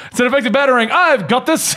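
a playful mock British superhero line; British accent. Voicing the heroine on the cover, with a British accent. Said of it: "Why is she British?".

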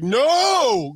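high, loud sharp shout. Retold or imitated. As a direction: stop him cold with one yell. Tommy Dreamer stopping Tracy.

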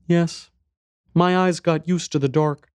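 put-on high, small child voice; little boy. A narrator's reading. Boy tells stranger about lost mother, little boy's voice. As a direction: speak as a young boy, pitched high.

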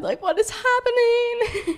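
very high, slow, strangled disbelief; mortified disbelief. Retold or imitated. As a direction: squeak it out in horror. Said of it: "I'm like".

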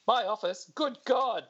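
high, panicked exclamation; alarmed. Acted. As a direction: yelp it in sudden alarm. Cam alarmed, rushing off.

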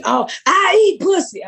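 high-pitched mock heckle; heckler impression. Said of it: "this bitch will heckle me".

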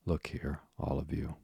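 ordinary delivery

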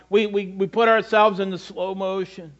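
ordinary delivery

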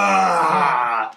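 a loud, guttural roar as the creature bursts out; monster roar. Retold or imitated. Mutating son roaring at his mother from the bathroom, loud roar. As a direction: roar like a man turning into a beast. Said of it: "And then he just goes".

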